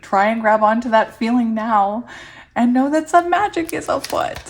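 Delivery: loud, rising, thrilled delivery; excited reading. Real, not acted. Reader urging listeners to trust the magic, excited. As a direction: build to a high, thrilled finish. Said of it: "Can you guys hear the excitement in my voice".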